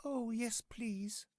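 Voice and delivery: high, breathless little yes; eager Thumbelina. A narrator's reading. Thumbelina accepting the swallow's offer, high and hushed. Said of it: "said Thumbelina".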